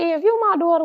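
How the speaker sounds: high, loud mock-mom doubt; startled challenge. Mother doubting the girl claiming to be her daughter, startled high challenge.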